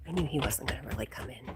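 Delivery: usual speaking voice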